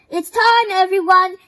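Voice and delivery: high, loud rallying call. A narrator's reading. Santa calling to his friends, loud high summons. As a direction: summon everyone from across the room.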